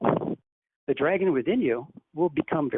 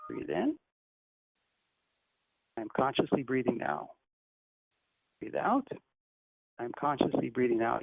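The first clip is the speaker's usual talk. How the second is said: softer, hushed meditation guidance; guided meditation, softer; 2 semitones lower, 7 dB quieter than usual. Acted. Teacher guiding the group's silent breathing, softer and hushed. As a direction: coax each breath in a soft, calm voice.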